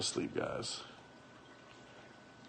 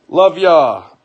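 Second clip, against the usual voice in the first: loud sign-off; 5 semitones higher, 12 dB louder than usual. Real, not acted.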